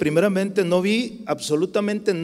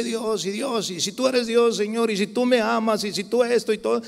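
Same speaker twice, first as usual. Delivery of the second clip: higher-pitched mock demanding prayer; mock whiny demand; 6 semitones higher than usual. People demanding signs from God, mimicked higher.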